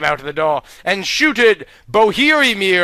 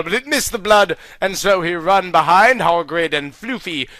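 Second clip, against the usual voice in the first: usual speaking voice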